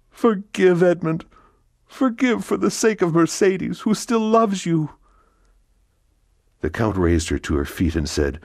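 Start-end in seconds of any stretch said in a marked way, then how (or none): 0.1-4.9 much higher, louder, begging on her knees; crying out for forgiveness. Acted. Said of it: "she cried out".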